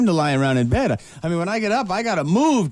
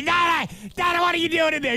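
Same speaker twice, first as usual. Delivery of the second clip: lightly voiced call to father, much higher; a touch of higher, quick calling out; 10 semitones higher than usual. Host recalling calling to his father, mildly mock calling. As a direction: call out higher to dad, half teasing. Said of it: "I'd say".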